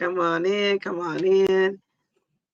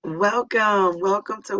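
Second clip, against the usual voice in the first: sing-song greeting; 2 semitones higher, 6 dB louder than usual. Real, not acted. Opening a live stream.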